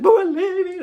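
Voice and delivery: high, loud mock wail; whiny, high-pitched cry of protest; imitated crying protest. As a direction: wail it like an upset kid. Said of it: "she's still crying".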